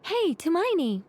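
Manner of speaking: mild mock call from behind; a hint of a shout across a street. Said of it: "someone behind you shouted".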